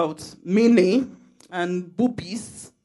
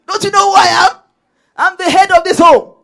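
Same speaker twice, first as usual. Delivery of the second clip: much higher, louder mock demand; pompous husband; 10 semitones higher, 12 dB louder than usual. Wife mimicking a husband who keeps demanding respect, loud and higher. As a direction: ham up a husband insisting he's the head. Imitating a bossy husband again.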